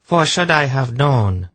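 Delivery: high voice of a haughty sorceress; Zandramas. A narrator's reading. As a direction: address the false god in a high voice. Sorceress goes on addressing false god.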